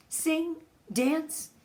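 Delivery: normal talking voice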